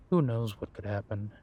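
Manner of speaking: ordinary talk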